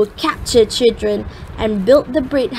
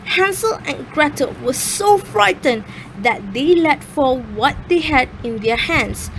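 ordinary delivery, nothing marked

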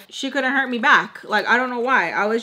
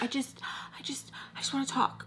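hurried, halting whisper; whispered plea; 4 semitones higher, 11 dB quieter, faster than usual. Retold or imitated. Roommate struggling to control herself, whispering a plea. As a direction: imitate someone whispering to keep control.

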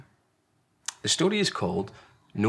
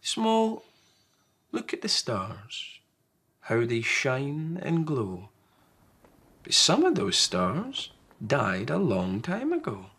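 Large, tender parent; 3 semitones higher, slower than usual. Acted. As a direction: hush the child with a lullaby tone. Large's tender closing words.

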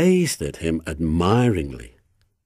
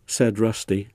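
plain reading voice

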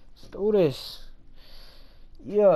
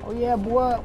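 normal talking voice

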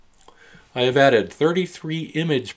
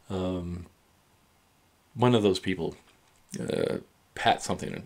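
halting word search, trailing off softly; real memory lapse, giving up quietly; groping for a name; 3 semitones lower, 4 dB quieter than usual. Real, not acted. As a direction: search for the name with ums and long gaps. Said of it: "My brain is fucked".

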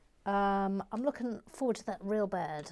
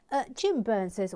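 usual speaking voice

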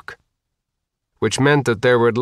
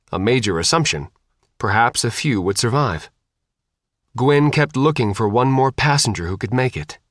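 usual speaking voice

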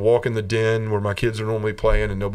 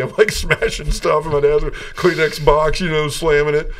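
laughing retelling; 6 semitones higher, 3 dB louder than usual. Real, not acted. Retelling his tantrum in car.

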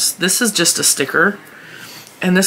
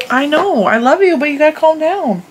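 soothing pet talk; 8 semitones higher than usual. Real, not acted. Mom calming her puppy while filming, high and coaxing. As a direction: promise the puppy, sweetly and high.

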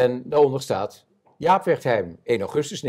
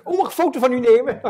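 much higher and fast, gushing fan; eager tourist; 13 semitones higher, 5 dB louder, much faster than usual. Retold or imitated. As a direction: gush like a star-struck visitor. Mocks eager tourist asking photo. (dweperige toerist, veel hoger en snel)

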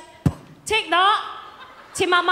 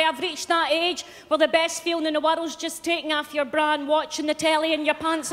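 usual speaking voice

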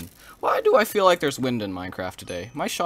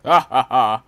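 loud triumphant ha ha ha; gloating laugh; 12 dB louder, slower than usual. Real, not acted. Gloats after big bow damage.

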